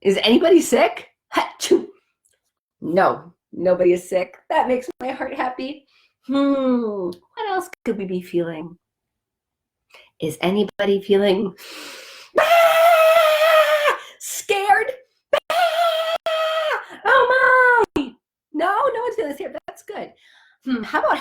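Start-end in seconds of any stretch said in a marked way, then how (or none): 1.3-1.9 mock sneeze; fake sneeze. Acting sick, fake sneeze. 12.4-18.1 high hammed-up scared shriek; mock scared. Acting scared for children.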